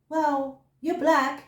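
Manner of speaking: ordinary talk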